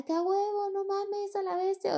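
very high screams of joy; thrilled screaming. Retold or imitated. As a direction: shriek it mid-air. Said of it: "Así que gritaba". (gritos agudos de emoción en el aire)

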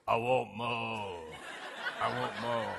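put-on low, slow little-girl demand; deep-voiced toddler. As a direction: drag it out deep like a toddler wanting milk. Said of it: "she'd go like this".

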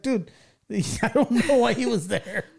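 slow, high, laughing through it; a kid laughing off his mom's question; laughing reply. Retold or imitated. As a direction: laugh it off helplessly.

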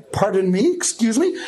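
acted disbelief, rising into a question; two questioning upswings, as if you misheard; incredulous. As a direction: do a baffled double-take. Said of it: "I look at her like".

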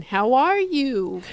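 slow "How are you?" with a clear rising question tune; question intonation. Shown as a demonstration. Therapist showing how a question rises. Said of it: "your voice going up when you ask a question".

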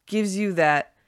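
usual speaking voice

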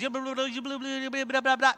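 rapid run-on blah-blah; nonstop babbling imitation. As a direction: mimic a friend who never stops talking. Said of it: "all they do is".

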